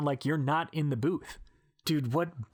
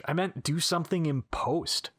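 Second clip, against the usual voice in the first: ordinary delivery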